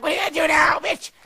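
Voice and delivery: shouted challenge; triumphant shout. Retold or imitated. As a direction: gloat as the new witch. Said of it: "and I'm like".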